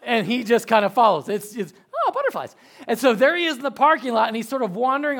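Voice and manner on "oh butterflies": high, laughing voice of a distracted little boy; dreamy kid. Dad imitating his son Jet spotting butterflies, high and laughing. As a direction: say it as a child chasing a butterfly. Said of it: "he'll be like".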